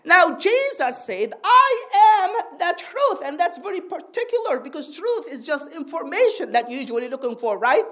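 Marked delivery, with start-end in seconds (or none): 1.4-3.2 slow, high-pitched declaration with "I am" stretched; raised, deliberate proclamation; emphatic quote. Retold or imitated. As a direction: proclaim it slowly, lifting "I am".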